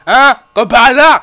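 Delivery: high, loud sarcastic cry; loud outburst. Real, not acted. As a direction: shout in disbelief. Reacting to game event.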